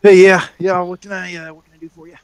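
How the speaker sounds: newcomer's voice. Acted. Spindly gentleman greeting waiting visitors, lightly voiced newcomer. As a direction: open loud, then tail away distractedly. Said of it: "be like".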